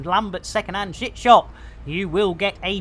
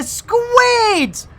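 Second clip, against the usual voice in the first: high surprised squeal; 4 dB louder than usual. Acted. Sudden shriek on squid.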